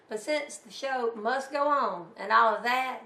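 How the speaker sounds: plain reading voice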